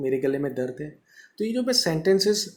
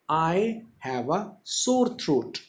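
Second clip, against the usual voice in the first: slow dictation; much slower than usual. Shown as a demonstration. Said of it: "जैसे थोड़ा सा मेरा भी हो रहा है".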